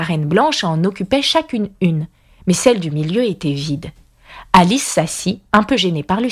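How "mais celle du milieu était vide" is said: usual speaking voice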